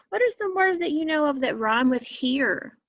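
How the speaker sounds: ordinary talk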